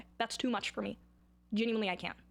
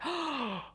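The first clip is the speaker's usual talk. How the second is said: high audible gasp; big theatrical intake; dramatic gasp; 4 semitones higher than usual. Pretend. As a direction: gasp as if scandalised. Reacts dramatically after mentioning [name].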